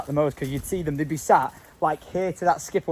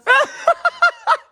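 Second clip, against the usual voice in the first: loud squealing laugh; real high giggle fit; high burst of laughter; 7 dB louder than usual. Real, not acted. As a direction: crack up at the joke. Friend jokes about tractor.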